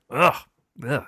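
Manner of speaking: wincing "ugh"; pained groan. Real, not acted. Host recalls eye hurt on table, wincing groan. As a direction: groan in sympathy at a painful hit.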